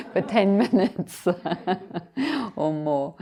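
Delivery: real laugh breaking through the words. Real, not acted. Teacher joking to settled meditators about getting comfortable, laughing. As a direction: chuckle through an amused aside.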